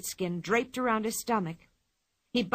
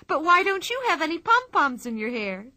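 Pippi voice; 7 semitones higher, 4 dB louder than usual. Acted. Pippi teasing the ringmaster.

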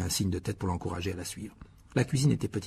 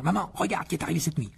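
higher-pitched mock shout to mum; raised pitch, without real volume; excited calling out; 7 semitones higher than usual. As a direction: pretend to announce a big surprise. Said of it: "en criant".

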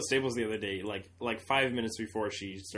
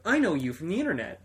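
higher, mildly excited recognition; retold excited usher; 6 semitones higher than usual. Usher recognizing internet performers after a play, mildly excited. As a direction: imitate an usher who just spotted someone.

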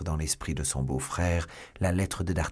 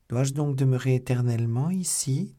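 higher, tense question with some fear; a touch of dread; 7 semitones higher, 3 dB louder than usual. A narrator's reading. Milady realising she is a prisoner, mild dread. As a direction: ask as if the walls close in. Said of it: "avec un certain effroi".

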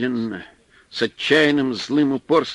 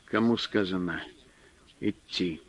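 softer, low threatening growl; low menacing; 4 semitones lower, 8 dB quieter than usual. A narrator's reading. Fyodor gripping the man's chest, menacing. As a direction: threaten him quietly.

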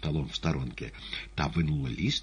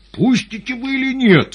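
very high, loud scream; terrible-voiced shout; 12 dB louder than usual. A narrator's reading. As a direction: scream it as a furious demand. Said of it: "страшным голосом крикнул поэт".

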